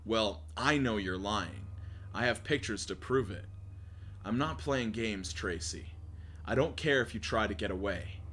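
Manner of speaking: low, measured stalker's voice; menacing email writer. Acted. Obsessed man warning Tracy he knows she lies, low menace.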